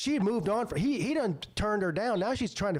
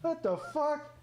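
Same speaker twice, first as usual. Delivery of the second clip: incredulous; 10 semitones higher, slower than usual. Retold or imitated. Retold incredulous reply to friend.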